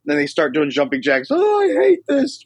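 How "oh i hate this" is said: slow, high mock whine; complaining buddy. Buddy grumbles through jumping jacks, high drawn-out mock whine. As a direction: moan it like a reluctant exerciser, pitched way up.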